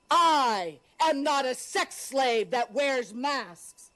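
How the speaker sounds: ordinary delivery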